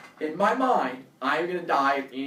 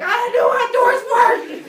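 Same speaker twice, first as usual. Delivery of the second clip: high, slow, laughing panic shout; panicked yell; 13 dB louder than usual. Retold or imitated. Kid stuck at the door, shrieking in panic.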